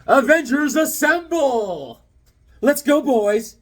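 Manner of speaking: rally the troops like Captain America; hammed-up battle call; rallying cry. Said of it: "They go".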